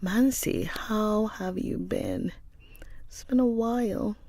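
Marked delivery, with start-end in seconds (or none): none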